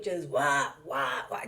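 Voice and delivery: low imitated retching sounds; mock retching. Showing viewers how she kept throwing up, low mock retching. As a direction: fake the heaving sounds in a low voice. Imitating vomiting on all fours.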